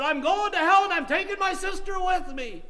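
high, loud, vehement vow; imitated defiant shout. Preacher quoting a man vowing to take his sister, defiant shout.

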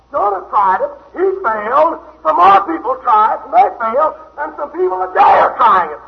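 loud, high preaching at full force; a revival preacher calling sinners home; fiery preaching. Acted. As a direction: thunder it out to the congregation. Preacher building again.

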